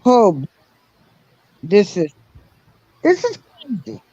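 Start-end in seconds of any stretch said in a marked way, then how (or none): none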